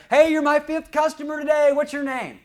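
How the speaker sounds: loud, high shout across the room; yelled greeting. Retold or imitated. Restaurant owner yelling to a new customer, loud and high. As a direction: call it out over everyone's heads. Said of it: "yelled out over the heads".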